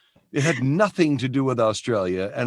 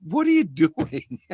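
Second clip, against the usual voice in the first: slow, higher mock-mother question; imitated mother; 4 semitones higher, slower than usual. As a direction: draw it out as a mother, lightly voiced. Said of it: "And my mother goes".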